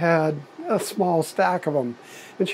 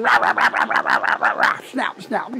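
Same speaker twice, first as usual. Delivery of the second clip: high yappy barks, sharp and quick; imitated dog barking; 5 semitones higher than usual. Dog chasing cows, yappy barking imitation. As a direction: bark like a small dog nipping at heels. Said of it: "barking and snapping at their heels and yapping".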